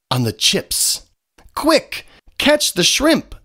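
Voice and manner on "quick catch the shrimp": high excited storybook yell; a hint of a yell, pitched up; chicken yells. A narrator's reading. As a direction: squeal it out as the chicken. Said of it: "yells the chicken".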